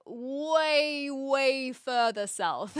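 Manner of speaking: "way, way" stretched out long and slow; drawn-out emphasis. Retold or imitated. New Zealander joking about being from the south, "way, way" drawn out. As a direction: drag out each "way" for comic distance.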